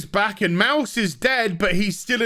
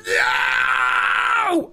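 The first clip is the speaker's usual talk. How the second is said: mock anguished cry; 6 semitones lower than usual. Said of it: "she's like".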